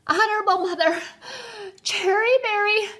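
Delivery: high, loud, broken bursts between gasps; gasping. A narrator's reading. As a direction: fight for breath after every few words. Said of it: "he gasped".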